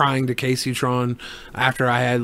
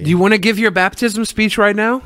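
ordinary talk, nothing marked

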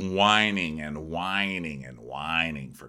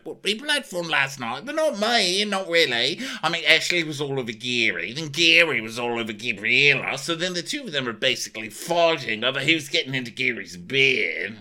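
lightly voiced gossiper, higher pitch; acted-out gossip; 5 semitones higher than usual. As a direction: dish the gossip in a raised mock voice. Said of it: "And she's like".

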